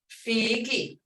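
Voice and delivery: "Figi" with a stretched first ee; long vowel with tone. Shown as a demonstration. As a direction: hold the tone in "Figi". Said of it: "when it's long, you do".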